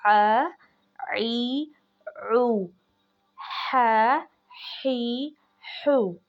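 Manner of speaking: आ ई ऊ pressed from mid throat, हा ही हू breathed; vowel drill. Shown as a demonstration. Teacher reading the vowels aloud, pressed then breathy. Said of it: "पढ़ के बता रही हूँ".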